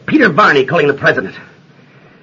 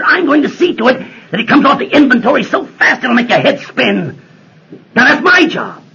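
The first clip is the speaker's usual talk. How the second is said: rising, higher, forceful threat; a senator threatening a general, forceful and raised; 7 semitones higher than usual. Acted. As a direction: threaten to shut the base down.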